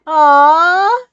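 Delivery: loud, high delighted aww. Real, not acted. Reader cooing at the story, loud and high. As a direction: coo loudly at the cute moment.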